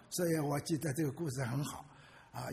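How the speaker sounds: ordinary delivery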